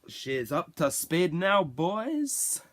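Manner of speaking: exaggerated mock-Scottish call, slow and climbing in pitch; Scottish accent. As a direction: belt it in a badly faked Scottish accent, rising at the end. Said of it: "put on my best Scottish accent".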